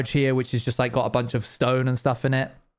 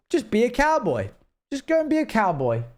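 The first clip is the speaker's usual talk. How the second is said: much higher, sing-song mocking tone; mock instruction; 11 semitones higher than usual. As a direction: parody the lazy game brief. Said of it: "it was just kind of like".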